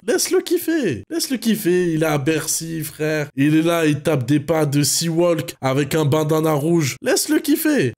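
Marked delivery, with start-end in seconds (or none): 0.1-1.9 excited. Real, not acted. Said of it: "répète en insistant". 7.0-7.9 excited. Real, not acted. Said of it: "répète en insistant".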